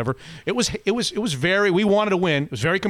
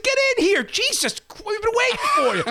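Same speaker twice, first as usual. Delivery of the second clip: urgent calling. Retold or imitated. Waving the guy into the game, high urgent call. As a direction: hurry him in like he's late. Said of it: "I said".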